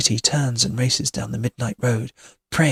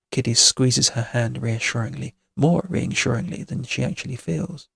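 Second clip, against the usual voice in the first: ordinary delivery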